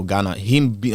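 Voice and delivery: usual speaking voice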